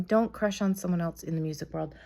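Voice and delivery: normal talking voice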